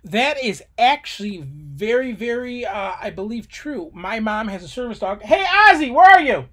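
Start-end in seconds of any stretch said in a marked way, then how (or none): 5.2-6.5 loud call across the house. Real, not acted. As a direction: call the dog from another room. Calling the dog in the house.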